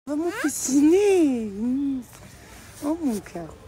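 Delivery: high, slow drawn-out cooing; doting high endearment. Real, not acted. Mother talks to her toddler.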